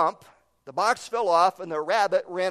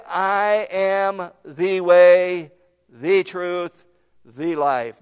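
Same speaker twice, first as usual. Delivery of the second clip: louder, slow "I am the way, the truth, the life"; emphatic declaration; 7 dB louder, much slower than usual. Shown as a demonstration. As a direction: proclaim each "the" slowly. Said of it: "Underscore the word "the".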